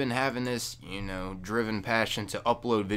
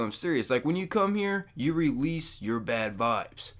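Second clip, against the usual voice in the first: ordinary talk, nothing marked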